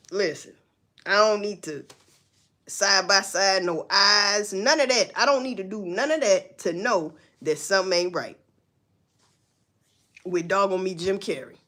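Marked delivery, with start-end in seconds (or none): none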